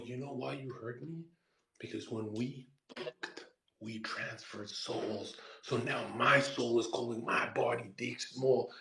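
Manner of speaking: soft, low, slowing confession; acted-out solemn, subdued reply; hushed grave explanation. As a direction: explain it quietly as if deeply wounded. Acts solemn hushed explanation to her.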